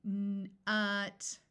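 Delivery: n and ut pulled apart; slow segmented word; said slowly. Shown as a demonstration. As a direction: break the word into slow sounds. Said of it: "I'm going to say a word slowly".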